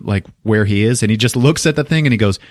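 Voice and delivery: usual speaking voice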